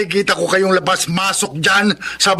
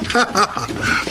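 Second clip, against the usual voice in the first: mocking laugh; 5 dB quieter than usual. Bully sneers at newcomers.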